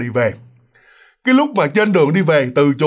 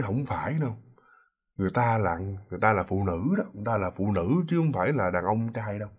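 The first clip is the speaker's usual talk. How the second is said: low, hushed and much softer; very quiet voice; 7 semitones lower, 10 dB quieter than usual. Acted. Thúy timidly correcting her friends, in a very quiet low voice. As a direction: say it small, pitched well down. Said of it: "mà nói nhỏ lắm".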